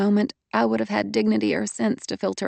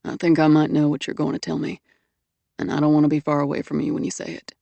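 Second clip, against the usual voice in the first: low, husky male voice, quicker at the end; husky man's voice; 5 semitones lower, faster than usual. A narrator's reading. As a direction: play him low and husky. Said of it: "he said in a husky voice".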